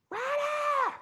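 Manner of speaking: high, drawn-out call, held back in volume; distant hollering. Retold or imitated. Mother still calling her son across the field, hollering. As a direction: call the name high and long, as if far off.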